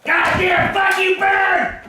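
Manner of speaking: drawn-out furious curse; slow angry shout. Real, not acted. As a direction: spit it slowly at the bird. Cursing the bird.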